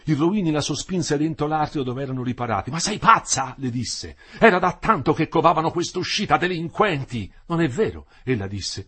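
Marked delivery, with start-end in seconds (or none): 2.7-3.6 loud, high scolding; angry fiancé scolding his bride. Acted. Fiancé scolds her in doorway. 4.4-7.3 loud, high contempt; furious tirade against rioters; angry ranting. Acted. Fiancé rails at demonstrators. 7.5-8.1 contradicting. Acted. She contradicts him.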